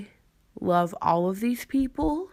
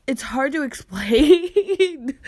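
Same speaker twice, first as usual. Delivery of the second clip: explains while laughing, a little higher and louder; 7 semitones higher, 4 dB louder, slower than usual. Real, not acted. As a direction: laughs through the words as they come out.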